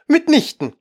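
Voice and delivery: high, emphatic denial; decided, very annoyed. A narrator's reading. Mouse contradicting Alice, very annoyed. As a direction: cut off the listener crossly. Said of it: "sagte die Maus entschieden und sehr ärgerlich".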